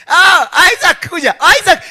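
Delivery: high, loud fond call; father calling son. Acted. Abraham calling Isaac to him, loud and high. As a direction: call the boy to come.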